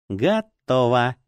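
lightly doll-like, slowly drawn out; thin little voice. A narrator's reading. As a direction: stretch it slowly in a small voice. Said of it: "прозвучал тоненький голосок совсем близко".

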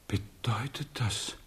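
whisper. Acted. Frightened townsman asks the king.

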